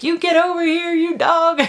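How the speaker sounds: very high, squealed comic command; mock arguing. Owner arguing with her scared dog, mock bossy squeal. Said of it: "like, I argue with him a lot".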